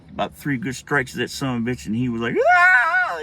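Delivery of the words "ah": loud, very high-pitched squeal; imitated kid's piercing shriek; pig-like squeal. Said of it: "He was squealing like a pig".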